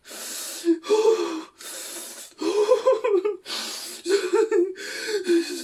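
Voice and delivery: high, loud laughing sob; overacted sobbing gasp; hammy sobbing gasp. Overacted grief scene.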